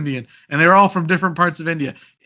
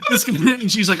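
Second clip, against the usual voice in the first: ordinary talk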